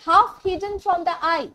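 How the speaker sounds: very slow; slow poem recitation. Shown as a demonstration. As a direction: lay out each word of the verse.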